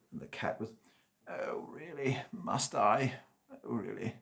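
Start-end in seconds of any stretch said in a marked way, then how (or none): 1.3-4.1 reluctant cat. A narrator's reading. Cat complaining it wanted to sleep all day, slow and grudging. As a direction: whine it slowly like a cat who'd rather nap.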